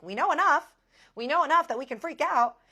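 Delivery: high, very loud retort; frantic shouting. Retold or imitated. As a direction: shout back in acted panic. Acted panicked retort to calm partner.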